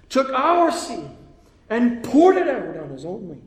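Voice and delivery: high, slow, impassioned proclamation; preaching at full force. Real, not acted. Preacher explaining why Jesus died, impassioned full-force preaching.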